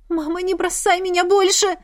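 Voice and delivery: high whimpering plea. Acted. Daughter whimpers to her mother not to leave. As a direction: whine it like a hurt child. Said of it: "захныкала Марина".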